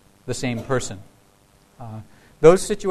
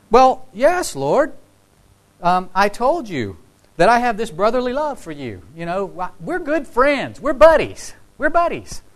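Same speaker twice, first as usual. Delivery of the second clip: much higher and louder, an eager, defensive protest; Peter's awkward dodge; 7 semitones higher, 3 dB louder than usual. Acted. Peter nervously protesting to Jesus that they are buddies, high and eager. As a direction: Peter squeaking out his excuses, slowing on the friend lines.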